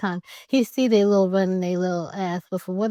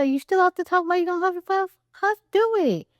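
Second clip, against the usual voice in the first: fast, much higher taunting jeer; mocking taunt; 10 semitones higher than usual. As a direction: egg the wolf on, high and quick. Pig taunting wolf through door.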